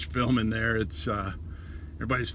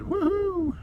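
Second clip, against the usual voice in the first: playful high-pitched whoop; high woohoo. Real, not acted. Cheering at getting paid to look around, high woohoo.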